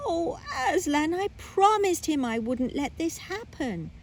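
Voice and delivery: high pleading anguish; distressed girl. Acted. Lucy begs Aslan, high and distressed. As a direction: plead with a catch in the voice.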